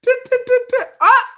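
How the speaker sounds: high sung duh duh duh to a cry of ah; hummed fanfare. Retold or imitated. Hyping her own entrance to comment trolls, high sung duhs. Hyped entrance sound effect.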